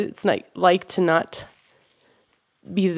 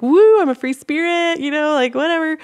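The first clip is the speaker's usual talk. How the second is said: carefree self; 8 semitones higher than usual. Retold or imitated. Mocking herself to the group as a free spirit, high and airy. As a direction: play the wild free spirit, pitched up. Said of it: "Like, I'm like".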